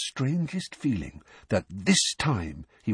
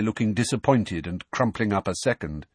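ordinary delivery

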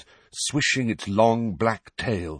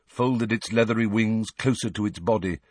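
ordinary delivery

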